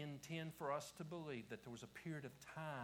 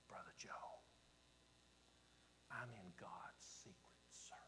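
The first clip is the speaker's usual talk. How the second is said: imitating a confidential whisper; 4 semitones lower, 11 dB quieter than usual. Man confiding to the preacher at the back door, hushed whisper. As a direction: lean in and whisper it as a secret, with pauses. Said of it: "he leaned over and he whispered".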